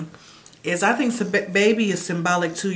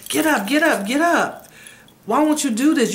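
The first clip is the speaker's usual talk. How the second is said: urgent retold urging; 7 semitones higher, 4 dB louder than usual. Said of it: "you're telling him".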